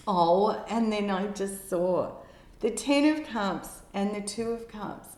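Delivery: thrilled to spot the cards; excited gasp. Real, not acted. Tarot reader sees love cards appear, excited. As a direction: voice jumps up high and loud with delight. Surprised by a new card.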